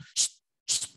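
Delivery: a quick hushing whisper, no voice; whispered shush. Retold or imitated. Girlfriend hushing him from beside Opa Johan, whispered. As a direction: hush him quietly so the old man won't notice. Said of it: "dia begitu".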